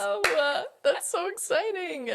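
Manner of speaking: high, giggly mock excitement; playfully hyping the silly segment name. Guest teasing the hosts about the segment name, mock excited.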